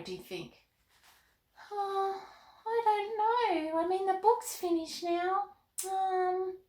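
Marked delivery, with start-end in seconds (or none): 1.7-6.6 put-on high, bright little girl puppet voice; Molly the puppet chatting with Carmen, in a high girl voice.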